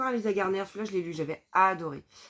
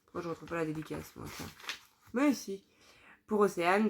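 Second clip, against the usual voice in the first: ordinary talk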